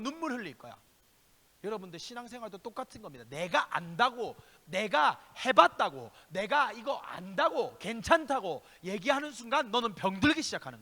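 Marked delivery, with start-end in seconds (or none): none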